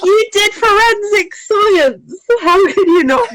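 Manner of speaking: you can't believe she didn't know; incredulous. Retold or imitated. Teasing the forensic-science friend, incredulous. Narrator mocking friend's ignorance.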